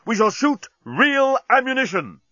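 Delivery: high, ringing battle command; rally the troops at a high-pitched yell; yelled orders. A narrator's reading. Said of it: "yelled Bolfas".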